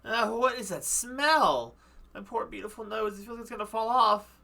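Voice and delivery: disgusted at a smell. Acted. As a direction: react like your poor nose is dying.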